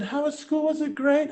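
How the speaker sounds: high-pitched imitation of an eager mom. Mom asking her son about school, high imitated voice. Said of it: "My mom said".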